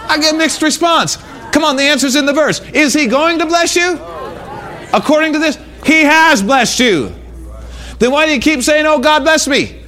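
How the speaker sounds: forceful preacher, pitch held high and dropping at phrase ends; full-force preaching. Real, not acted. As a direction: preach it hard to the congregation. Preaching to congregation.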